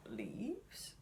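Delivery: hushed reveal of Leaves; soft whispered name. Real, not acted. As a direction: breathe the word Leaves softly.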